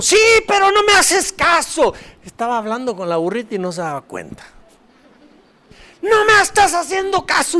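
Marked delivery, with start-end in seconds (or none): 0.0-1.9 high, loud angry retort to the donkey; Balaam yelling at his donkey; angry scolding. Retold or imitated. 6.0-7.6 high, loud angry retort to the donkey; Balaam yelling at his donkey; angry scolding. Retold or imitated.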